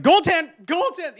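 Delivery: loud, high mock outcry at a bad call; pissed announcers. Imitating announcers pissed about a call, high indignant shout. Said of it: "the announcers were pissed about at first".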